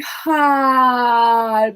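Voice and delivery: open-mouthed exhale on ha; breathy yawning ha. Shown as a demonstration. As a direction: push the breath out on ha. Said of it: "Breathing out through the mouth".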